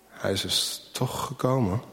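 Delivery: slow and soft, amazed. A narrator's reading. Old shoemaker slowly realises his guest came. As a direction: let each word land slowly. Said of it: "zegt hij langzaam".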